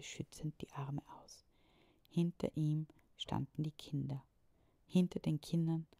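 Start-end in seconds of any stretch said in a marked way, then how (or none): none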